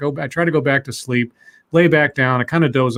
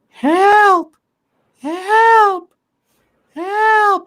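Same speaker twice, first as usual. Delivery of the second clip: very high, loud, drawn-out cries; imitated yelling for help; 7 dB louder, much slower than usual. Retelling a stranger yelling in the street, imitated high yell. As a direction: wail for help up in falsetto. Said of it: "I hear yelling".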